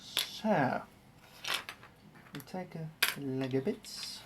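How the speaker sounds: narrating each step in a hammed-up German accent; mock German accent with z for th; bad German accent. Streamer narrating his Lego build in a mock German accent. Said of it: "In a German accent, apparently".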